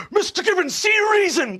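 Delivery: high pleading cry; desperate plea. Acted. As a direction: beg him to see reason.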